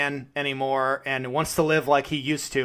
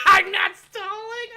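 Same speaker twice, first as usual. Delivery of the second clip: high, drawn-out protesting cry; mock protest; slower than usual. Host accused of stalling by co-host, mock-indignant protest. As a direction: protest too much, pitched way up.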